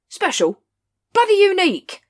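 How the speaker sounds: loud, high exasperated outburst; captain blowing up over the ape, loud and high. A narrator's reading. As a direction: explode in disbelief. Vimes exasperated.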